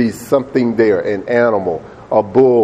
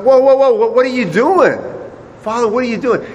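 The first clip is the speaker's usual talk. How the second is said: high, urgent pleading; alarmed protest; 11 semitones higher than usual. Acted. Isaac to his father binding his hands, alarmed protest. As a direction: protest in rising alarm, pitched way up.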